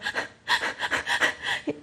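breathy, voiceless sobbing gasps; imitated sobbing. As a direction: mimic a child catching breath in sobs. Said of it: "he's going".

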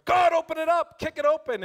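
loud, high begging shout to God; desperate pleading cry. Retold or imitated. Believer shouting at God to kick the door open, loud plea. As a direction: cry out like someone stuck at a locked door.

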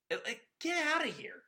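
high-pitched scoffing disbelief; incredulous dismissal. Real, not acted. Host scoffs at the two-pickoff rule, incredulous. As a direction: wave it off as absurd, voice jumping high.

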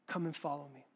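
gentle Jesus voice. Acted. Pastor voicing Jesus calling Peter, hushed and gentle. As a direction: voice Jesus inviting quietly.

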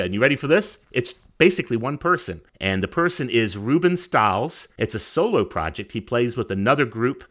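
ordinary talk

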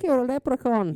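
very high, loud cartoon Irish. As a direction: squeal it like a cartoon Irish leprechaun. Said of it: "start with just being, like, stereotypically".

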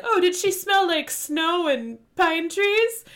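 high, slow mock-British musing; mock British nonchalance. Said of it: "the Prime also nonchalantly is like".

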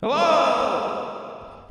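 usual speaking voice

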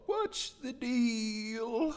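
drawn-out high falsetto title call; silly high segment-name voice; mock title announcement. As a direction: stretch it out slowly, way up high.

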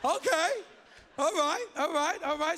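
loud, high, eager self-congratulation; hammed-up eager anticipation; cocky excitement. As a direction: play a husband thrilled at his luck. Cocky husband expecting a romantic reward.

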